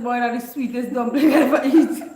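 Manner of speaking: loud, laughing praise of the dumpling; laughing children. Retold or imitated. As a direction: shout it through laughter. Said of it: "them children said".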